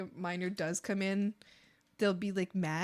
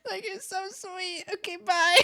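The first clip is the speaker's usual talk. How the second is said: gushing sweetness; 10 semitones higher, 4 dB louder than usual. Retold or imitated. Gushing at sweet young chatter.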